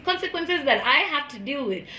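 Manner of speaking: ordinary talk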